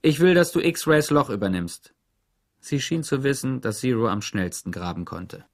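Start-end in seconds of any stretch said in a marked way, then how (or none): 0.0-1.9 the Warden. A narrator's reading. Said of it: "sagte die Chefin".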